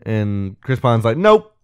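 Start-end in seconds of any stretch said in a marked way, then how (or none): 1.2-1.5 much higher and louder nope; curt mock denial. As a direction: snap it out cheekily. Chris Pine's curt retort.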